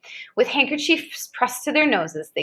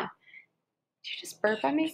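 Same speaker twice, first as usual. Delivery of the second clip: slow, much softer, mock-offended; soft mock shock; 3 semitones higher, 10 dB quieter, slower than usual. Real, not acted. Mother to a child who burped on her, soft mock offense. Child burps during reading.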